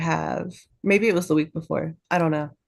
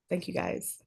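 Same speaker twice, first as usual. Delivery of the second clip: whispered thanks; 10 dB quieter than usual. Real, not acted. Softly thanking patrons.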